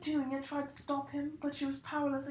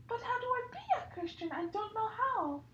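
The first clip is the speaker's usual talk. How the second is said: put-on much higher, fast little boy; eager child question; 9 semitones higher than usual. Harry asking Hagrid, high fast child voice.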